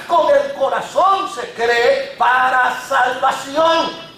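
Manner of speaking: high, loud fervent preaching; full-force preaching. Acted. Preacher proclaiming the Holy Spirit's hope, fervent and loud. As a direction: proclaim it to the congregation.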